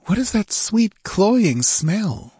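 high, slow, nervous female voice. A narrator's reading. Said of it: "she asked nervously".

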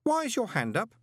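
high-pitched innocent question; cheerful boy. A narrator's reading. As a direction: ask it as a curious lad.

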